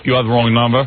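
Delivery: lightly disguised phone voice; phony voice. Retold or imitated. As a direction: pretend to be someone else on the line. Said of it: "keep the phony voice and go".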